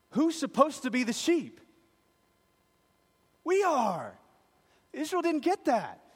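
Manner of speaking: high, loud, urgent preaching; preaching at full force. Real, not acted. Preacher pressing the congregation on who the lamb is, loud and high. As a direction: drive it home at full force.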